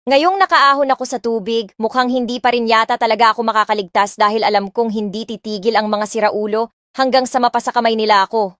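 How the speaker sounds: usual speaking voice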